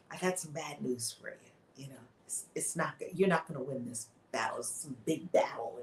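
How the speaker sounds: low, quiet, heavy delivery of doom; grave bad news. Witch telling the king he will lose, low and grave. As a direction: break it to him gently but gravely.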